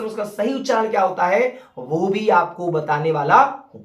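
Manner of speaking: normal talking voice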